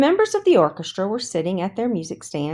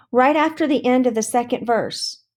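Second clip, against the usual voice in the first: prompt reply; 6 semitones higher than usual. A narrator's reading. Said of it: "John promptly replied".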